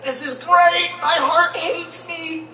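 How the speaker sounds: high, loud gleeful cry then slow; hammed-up joy at eating steak; mock delight. Comic eating steak, gleefully doomed. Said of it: "It's like".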